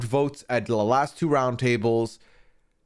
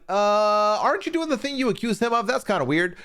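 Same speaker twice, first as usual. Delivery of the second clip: higher-pitched, doubting callout; mock skeptical challenge; 7 semitones higher than usual. Imagined player calling out Dylan's double standard, skeptical. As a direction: question it with a drawn-in, suspicious "uh".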